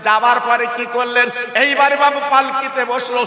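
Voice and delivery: loud chanted sermon with long drawn line endings; impassioned religious storyteller; full-force preaching. Acted. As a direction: preach it out, ringing and sing-song.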